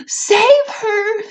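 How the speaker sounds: high piercing scream of panic; frantic mother screaming for her daughter. Acted. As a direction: scream it at the top of your voice. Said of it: "screamed Missus Salt".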